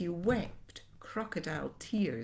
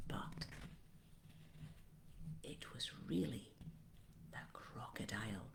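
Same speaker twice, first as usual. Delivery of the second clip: whisper; 7 semitones lower, 16 dB quieter than usual. A narrator's reading. Spooky reveal of the crocodile.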